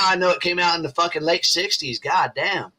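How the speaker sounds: ordinary delivery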